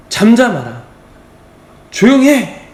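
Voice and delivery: high, loud shushing of the crowd; rebuking. Acted. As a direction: scold like an irritated bystander. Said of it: "많은 사람들이 꾸짖어 이르되". (큰 소리로 꾸짖듯이)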